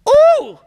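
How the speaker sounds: high, loud, delighted "ooh"; excited ooh. Real, not acted. Preacher hyping the crowd, excited high whoop. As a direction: whoop at your own point. Preacher reacting to own line.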